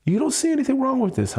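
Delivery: high, fast incredulous demand; fast, high exasperated challenge. Real, not acted. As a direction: press her, rising in disbelief. Confronting partner about delisted coins.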